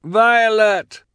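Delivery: high desperate call. A narrator's reading. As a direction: shout her name over the roar. Triplet calling from the water.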